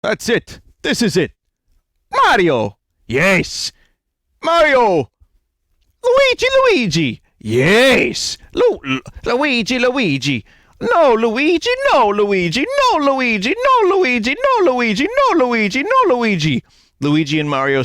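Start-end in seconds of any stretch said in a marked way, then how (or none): 0.0-1.3 higher pitched cartoon line; high retake. Shown as a demonstration. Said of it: "Let's try that again". 2.1-16.6 much higher squeaky plumber voices; high cartoon voices. Voicing Mario and Luigi.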